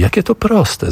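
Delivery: high joyful cry; exclaiming. Acted. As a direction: cry it out in sudden relief. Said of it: "zawołała".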